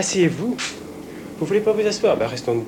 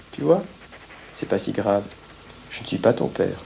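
low, measured soothing; calm reassurance; 7 semitones lower, 5 dB quieter than usual. Acted. Reassuring the child after the shouting, calm. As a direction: settle the child in a lowered voice.

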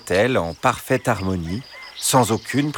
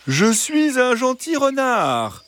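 very high, slow sugary fox; gentle, reassuring fox; slower than usual. A narrator's reading. Fox presents himself to the hens as kind, reassuring. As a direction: coax sweetly, high and drawn out. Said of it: "Une voix douce et rassurante".

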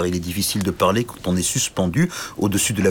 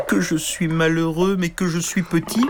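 whimpering lament; 9 semitones higher than usual. A narrator's reading. Little knight sobbing alone at cave mouth, whimpering. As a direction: whine your misery aloud. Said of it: "Il gémissait tant et tant".

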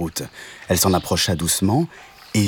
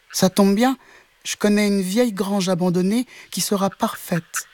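high, sustained kitten voice; small kitten; 12 semitones higher than usual. A narrator's reading. The cat offers the group an old barn, in a high voice. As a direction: keep it high as a helpful kitten. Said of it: "dit le chat".

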